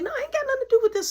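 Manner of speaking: high, loud, rushed backing-off voice; mock squeaky denial. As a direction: squeak it out fast like he's dodging blame. Imitating Mark backing off.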